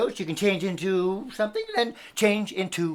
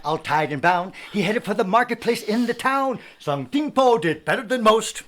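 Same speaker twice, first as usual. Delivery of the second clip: ordinary talk, nothing marked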